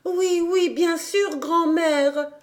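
put-on little girl reassuring her grandmother; joyful child exclaiming; cried out. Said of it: "s'écria-t-elle".

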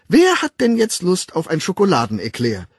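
high, loud, put-on cheerfulness; forced cheer. A narrator's reading. Nurse lures the twins with a treat, in forced cheer. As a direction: sell the treat brightly to distract them. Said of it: "in betont munterem Ton".

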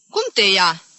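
loud, keen query; interested question. Acted. Aunt asking the sufferer about her attacks, interested. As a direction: lean in with lively curiosity. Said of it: "întrebă cu interes tanti Genca".

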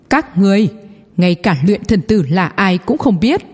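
ordinary delivery

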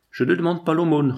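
high, proudly offended reply; dignified retort. Acted. Manzana refusing his partner's offer of money, dignified retort. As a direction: draw yourself up with wounded dignity. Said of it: "répliqua Manzana d'un air digne".

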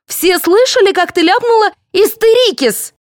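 high, loud taunt in front of everyone; loud jeer. A narrator's reading. Actress mocking a colleague's slip before the cast, jeering.

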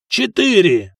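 high, loud gambler's cry; excited shout. A narrator's reading. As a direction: shout the throw with relish. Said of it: "азартно крикнул Сигурд".

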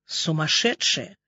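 low, soft, slow angry hiss. A narrator's reading. Doctor leading Ksyusha away, hissing at the heroine. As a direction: spit it out under breath. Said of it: "прошипела Настя".

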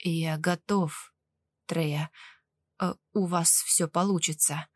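low, hesitant patient with understated fear; subdued, uneasy readiness; willing but fearful. A narrator's reading. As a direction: agree haltingly, keeping the voice low. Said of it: "с готовностью и страхом в голосе подтвердил".

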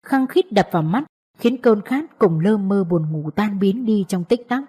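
ordinary delivery, nothing marked

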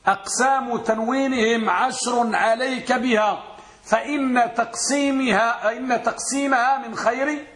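slow, chanted verse recitation; recited verse. Shown as a demonstration. Teacher chanting a verse to his students, slow and measured. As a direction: intone the poem line slowly. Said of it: "ولهذا قال".

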